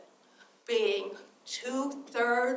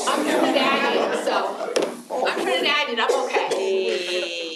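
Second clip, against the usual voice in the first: laughing through the words, louder; half-laughing joke about being Trinidadian; 8 dB louder than usual. Real, not acted. Audience member jokes she is Trinidadian, laughing loudly. Joking reply about national identity.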